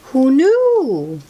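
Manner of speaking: plain reading voice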